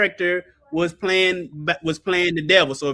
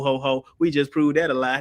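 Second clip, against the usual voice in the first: usual speaking voice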